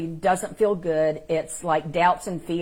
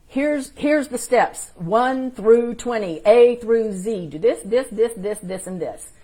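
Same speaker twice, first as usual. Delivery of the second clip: mock list-reciting voice; 5 semitones higher, 7 dB louder than usual. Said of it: "it's not gonna just say".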